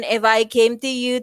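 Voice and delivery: dull, droning monotone; monotonous. Shown as a demonstration. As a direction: drone it out as if bored, dragging at the end. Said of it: "sounded like this".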